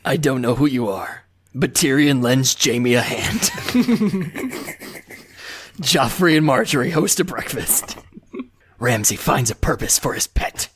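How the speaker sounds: mock-menacing action-hero impression; Liam Neeson. Shown as a demonstration. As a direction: read episode notes as a deadly threat. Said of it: "I think you almost had him there".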